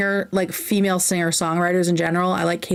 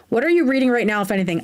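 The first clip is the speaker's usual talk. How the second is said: normal talking voice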